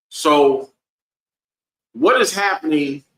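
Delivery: ordinary delivery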